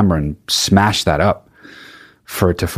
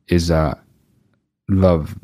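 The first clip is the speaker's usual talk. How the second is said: normal talking voice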